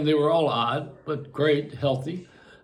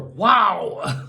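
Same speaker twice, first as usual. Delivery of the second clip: mock awe; 3 semitones higher than usual. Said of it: "You know, in awe".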